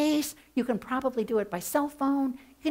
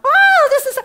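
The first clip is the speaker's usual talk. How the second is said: much higher and louder, hammed-up delight; mock squeal; 13 dB louder than usual. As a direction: squeal like a studio audience fan. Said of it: "come on, squeal".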